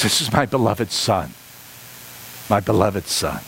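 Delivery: solemn voice of God. Retold or imitated. Quoting God's voice from heaven.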